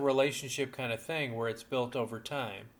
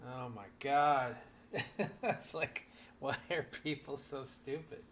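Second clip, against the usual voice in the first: laughing disbelief; 4 dB quieter than usual. Real, not acted. Friend laughing at the situation.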